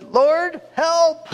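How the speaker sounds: high, loud cry to the Lord; a desperate retold plea for help; panicked prayer. Man rushed by armed police, crying out loud to God.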